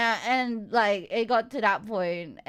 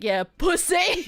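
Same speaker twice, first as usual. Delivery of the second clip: retold shout; 6 semitones higher, slower than usual. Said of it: "Me shouting in the background".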